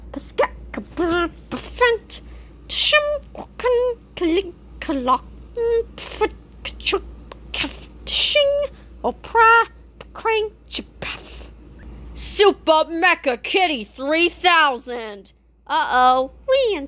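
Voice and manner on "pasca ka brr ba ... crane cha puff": robot transforming sounds. Acted.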